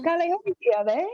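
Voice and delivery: made-up language, lightly sung; playful gibberish greeting; fake foreign language. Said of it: "they created this fake language".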